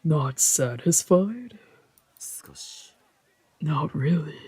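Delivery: soft, slow, understated dialogue; quiet character exchange. Acted. Voicing two fighters' quiet banter, softly. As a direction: let the lines fall low and hushed. Game dialogue read aloud.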